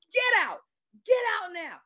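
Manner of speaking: angry order. Retold or imitated. Doctor ordering the chaplain out of the room, angry shout. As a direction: throw her out of the room.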